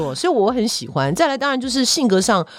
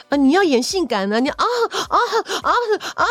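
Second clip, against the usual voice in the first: mock sexy moans; 10 semitones higher than usual. The camp co-star vamping in rehearsal, high hammed-up sexy moans. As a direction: ham up the seductive sighs. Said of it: "那个娘娘嘛".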